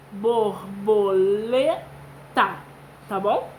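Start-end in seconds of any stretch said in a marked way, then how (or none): 0.1-1.8 "Borboleta" slowly, each syllable apart; slow sounding out. Shown as a demonstration. Teacher saying the whole word slowly for the class. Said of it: "falar com calma".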